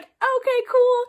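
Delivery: high, loud hammed-up okay; mock-cheery reaction. Girl retelling him joining the table, high mock-cheery reaction.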